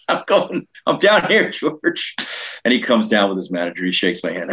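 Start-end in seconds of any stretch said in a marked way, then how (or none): 0.1-2.2 high, thin mock call upstairs; calling back. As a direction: answer someone calling from another floor.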